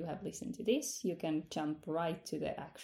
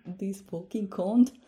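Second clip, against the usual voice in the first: mock hostile commenter; 2 semitones higher, 9 dB louder, slower than usual. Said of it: "Yes, I am commenting".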